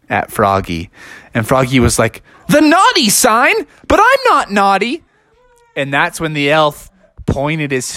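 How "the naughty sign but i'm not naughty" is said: high-pitched indignant little frog; shocked, rising disbelief; dismayed protest. Acted. Froggy answers the elf's naughty sign, dismayed and high.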